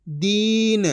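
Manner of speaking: दीन with a held ī and light final n; long vowel, half last letter. Shown as a demonstration. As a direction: stretch दीन into "deeen". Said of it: "उसका उच्चारण आधा करेंगे".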